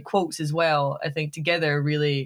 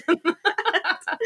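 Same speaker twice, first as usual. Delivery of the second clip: high laugh; 12 semitones higher than usual. Real, not acted. Laughing at her accent story.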